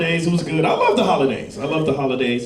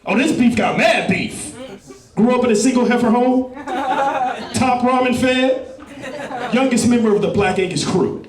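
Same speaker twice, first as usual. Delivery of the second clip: higher, pumped-up street storyteller; street hype voice; 5 semitones higher than usual. Retold or imitated. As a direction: hype up the beef's backstory like a rap bio. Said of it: "He goes".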